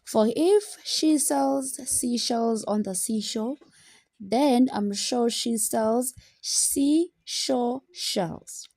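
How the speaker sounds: slow, careful recital of a tongue twister; slow poem recital; poem reading. Acted. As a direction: read the verse slowly, savouring each s. Reads tongue-twister poem aloud.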